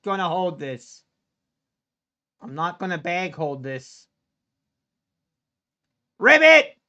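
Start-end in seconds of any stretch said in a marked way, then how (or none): 6.2-6.8 high, loud frog croak; sudden loud ribbit. Real, not acted. Frog sound as the trade flops.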